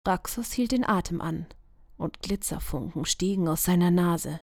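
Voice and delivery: ordinary delivery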